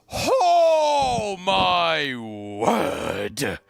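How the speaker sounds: very slow, loud drawn-out shock; stunned exclamation. Real, not acted. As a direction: stretch it out in disbelief. Huge gift shock.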